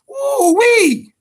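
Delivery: loud, high ooh-wee; ooh-wee holler. Real, not acted. Remembering the Santa Cruz fight, loud high whoop. Reaction to Gary Russell incident.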